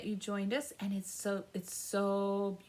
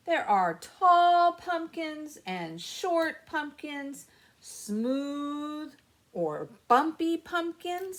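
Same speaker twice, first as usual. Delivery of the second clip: louder, higher and slow with delight; excited reading; 7 semitones higher, 7 dB louder, slower than usual. Acted. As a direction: marvel at it for the kids.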